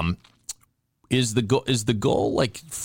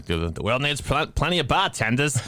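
higher-pitched bad Boston accent; mock an actor's overdone Boston accent; Alec Baldwin; 7 semitones higher than usual. Host mocking an actor's movie accent, bad Boston accent. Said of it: "what kind of accent he was trying".

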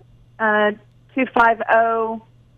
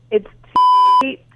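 exasperated; 4 dB louder than usual. Real, not acted. Wife repeating number, exasperated.

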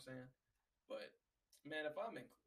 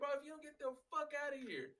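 high, pushed warning to a coughing coworker; pitched-up mock threat; retold angry warning; 12 semitones higher than usual. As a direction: threaten him to leave. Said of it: "I'm like".

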